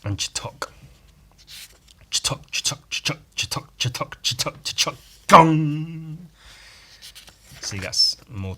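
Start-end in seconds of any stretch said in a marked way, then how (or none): 2.1-4.6 unvoiced rhythmic chatok; whispered sound effect. Reading sound effects aloud. 4.6-6.3 slow loud high gong; big drawn-out gong; booming sound effect. Pretend. Reading sound effects aloud.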